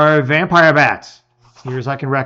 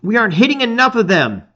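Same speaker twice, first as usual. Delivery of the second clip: higher, fast urgent call; shout; 9 semitones higher, faster than usual. A narrator's reading. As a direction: rush it out in the fight. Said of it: "you shout".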